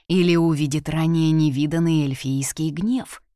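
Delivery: ordinary delivery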